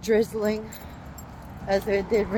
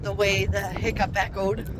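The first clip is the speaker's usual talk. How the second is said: ordinary delivery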